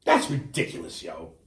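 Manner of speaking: ordinary talk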